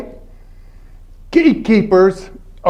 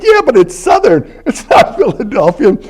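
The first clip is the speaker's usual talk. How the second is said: put-on girlfriend teasing; 5 semitones higher than usual. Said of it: "But she said".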